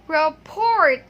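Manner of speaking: "report" loud and stretched, with final T sounded; mispronounced rapport. Retold or imitated. Teacher echoing the learner's answer, loud mispronunciation. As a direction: boom "report" in two parts, hard T. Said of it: "I heard you said".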